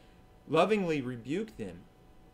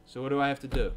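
normal talking voice

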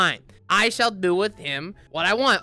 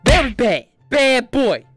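loud mock telling-off; hammed-up scolding of a bad boy; 5 dB louder than usual. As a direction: scold him like a naughty pet, louder. Scolding a player avatar.

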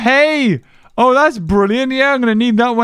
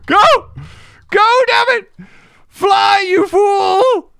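frantic shouting; 12 semitones higher, much slower than usual. Real, not acted. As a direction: yell at it to go. Urging his flying mount to flee.

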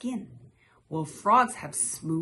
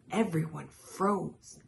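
plain reading voice

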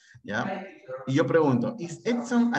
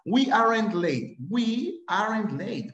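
slow, higher, drawn-out teacher model; slow model reading; 7 semitones higher, much slower than usual. Shown as a demonstration. Teacher modelling an English sentence for students: slow and drawn out. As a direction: spell it out slowly for the class. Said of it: "teacher models the sentence slowly".

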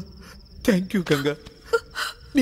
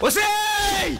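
sharp call; 11 semitones higher, 3 dB louder, much slower than usual. Acted. Man calls out to the woman.

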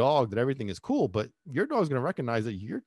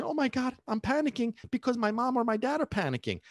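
high-pitched mock panic; acted, high voice of alarm; panicking child; 10 semitones higher, faster than usual. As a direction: imitate a kid freaking out. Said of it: "if you're freaking out".

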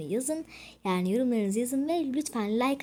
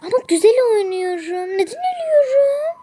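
mock crying; 12 semitones higher, 11 dB louder than usual. Whining about dying in game.

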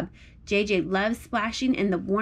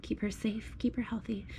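a soft prayer whisper; 10 dB quieter than usual. Real, not acted. Mom whispers a blessing over her sleeping daughter.

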